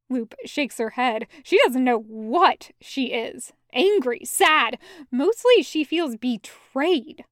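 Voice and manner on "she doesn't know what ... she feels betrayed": ordinary delivery, nothing marked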